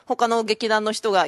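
ordinary talk

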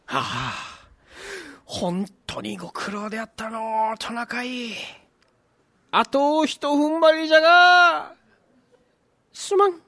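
put-on old Santa voice.

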